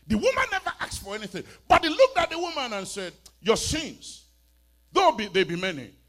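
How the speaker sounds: high, loud, fast excited preaching; shouted joyful proclamation; preaching climax. Real, not acted. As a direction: build it up and ring out.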